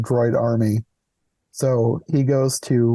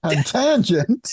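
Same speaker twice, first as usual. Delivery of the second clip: mock surprise; 9 semitones higher, slower than usual. Real, not acted. Teasing repeat of word.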